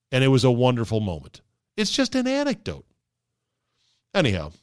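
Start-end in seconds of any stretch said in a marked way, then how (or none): 1.8-2.9 mocking. Mocking retort.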